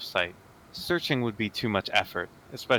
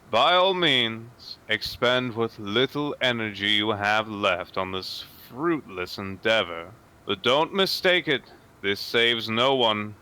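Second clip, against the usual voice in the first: slow, loud, icy divine threat; measured contempt, every word weighed and slow; cold menacing god; 4 dB louder, slower than usual. Acted. The proxy god coldly taunting Frieza, slow and menacing.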